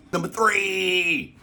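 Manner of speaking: very high, long-held call; drawn-out high-pitched showman's call; countdown announcer. Real, not acted. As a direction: sing out the number, stretching the last word. Calling out the countdown number.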